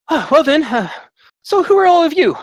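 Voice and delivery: a suave bard sweeping into the room; high bard voice. Acted. Bard Thanor greeting the party, high and showy.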